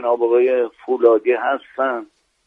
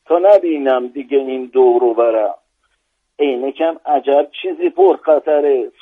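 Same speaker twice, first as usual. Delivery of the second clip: put-on Isfahani-accented comic verse recitation; isfahani persian; 3 semitones higher than usual. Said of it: "یه شعر با لهجهٔ اصفهانی من گفته بودم".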